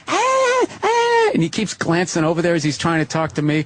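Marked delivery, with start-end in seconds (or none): 0.1-1.3 put-on high squealing bar-girl voices; imitating women's voices rising in a bar; mock high girly chatter. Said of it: "they sit there talking like".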